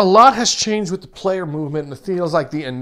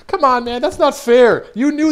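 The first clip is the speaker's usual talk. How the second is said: high, loud complaint; indignant protest; 8 semitones higher, 7 dB louder than usual. Real, not acted. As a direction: protest loudly at the unfair trap. Protesting an unfair game trap.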